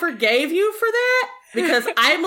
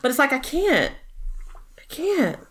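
ordinary talk

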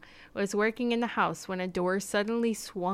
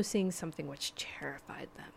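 whisper; 4 semitones lower, 8 dB quieter than usual. Acted. Spooky storytelling turn.

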